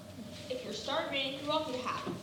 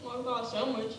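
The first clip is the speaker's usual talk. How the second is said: muffled, as if through a wall; 10 semitones lower, 4 dB quieter, faster than usual. Real, not acted. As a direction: speak with sound blocked. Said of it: "You sound muffled".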